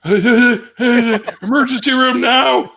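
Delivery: high loud gasping call between laughs; mock panicked yell. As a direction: yell it as if dying. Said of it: "I'll be like".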